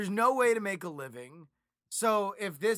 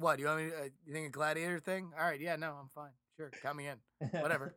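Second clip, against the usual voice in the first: indifferent; 5 semitones lower, 8 dB quieter, faster than usual. Acted. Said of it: "And I'm just like".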